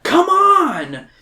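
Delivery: very high, loud rallying yell. Acted. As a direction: shout at them to follow you. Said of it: "You yell".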